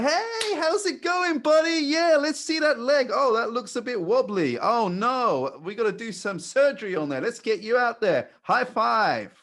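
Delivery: very high, loud gushing doctor; over-excited doctor. Acted. Mock doctor greeting a patient, over-excited. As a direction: ham it up with absurdly high, cheery hype. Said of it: "your doctor and he's like".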